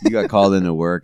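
ordinary delivery

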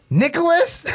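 high, sharp shriek of his name; mom shrieking his name. Retold or imitated. Mom on speaker shrieking at her son.

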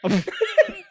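loud high-pitched laugh. Real, not acted. Big laugh at impression.

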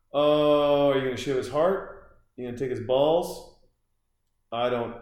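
usual speaking voice

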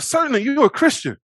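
high, loud rapid-fire accusations; mock accusation. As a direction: imitate accusers pointing someone out. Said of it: "They're like".

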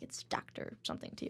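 hushed, voiceless put-down; very soft mock correction; retold snub. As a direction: drop to a near-whisper, imitating a snub.

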